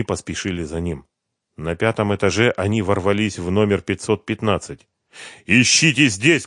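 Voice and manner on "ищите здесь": loud, slow, emphatic command; raised, forceful voice; shouted order. A narrator's reading. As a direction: call out an order to search. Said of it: "крикнул Дронго".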